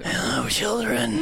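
loom over them like death; grim reaper voice. Acted. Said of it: "I'm gonna keep murder my voice".

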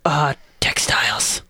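hushed whisper. Acted. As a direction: whisper it reverently. Wizard toasts in whisper.

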